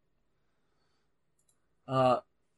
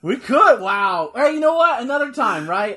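excited; 4 semitones higher, 7 dB louder than usual. Real, not acted. Excited at the wrestling clip.

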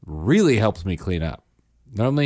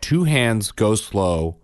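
patient instruction; much slower than usual. Retold or imitated. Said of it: "I say".

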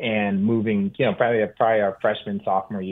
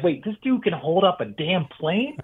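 mind-blown disbelief; 8 semitones higher than usual. Retold or imitated. Mind-blown eighteen-year-old.

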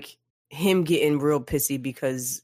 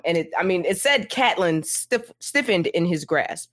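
ordinary talk, nothing marked

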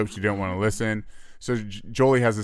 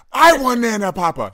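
put-on high, loud whiny toddler voice; hammed-up whiny kid; mocking a whining child; 13 semitones higher, 9 dB louder than usual. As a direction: throw the tantrum back at her. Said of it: "I start mocking her".

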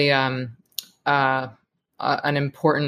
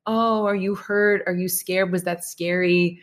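concerned parent; 4 semitones higher than usual. Retold or imitated. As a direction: fuss over a crying child. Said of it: "I could run up and be like".

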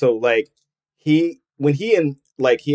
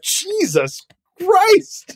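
shocked; much slower than usual. Retold or imitated. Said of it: "you're like".

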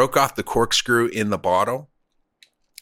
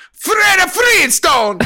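high slow shouted fake Italian; Italian accent; 6 dB louder, much slower than usual. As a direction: bellow it like a cartoon Italian. Repeats the Italian bit.

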